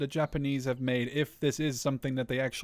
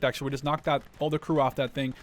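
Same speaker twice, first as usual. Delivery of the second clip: usual speaking voice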